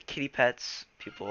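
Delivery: usual speaking voice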